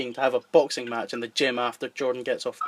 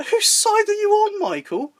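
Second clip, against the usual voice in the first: indignant protest; 3 dB louder than usual. Acted. Jordan indignant at friend.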